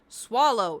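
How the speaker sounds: loud order to the cat; firm command. Retold or imitated. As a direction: call it out sharply. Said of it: "and so I go".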